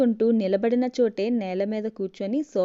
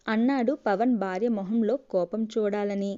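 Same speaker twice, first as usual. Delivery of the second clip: ordinary delivery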